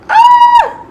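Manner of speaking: loud high shriek of excitement; excited scream. Real, not acted. Seeing the new doll, screaming with excitement. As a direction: shriek with pure joy. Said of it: "I'm just so excited".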